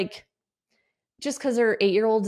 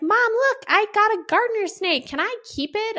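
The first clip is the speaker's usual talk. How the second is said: put-on much higher, eager little boy voice; excited kid; 13 semitones higher than usual. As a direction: play a thrilled kid holding up a snake. Excited son showing snake.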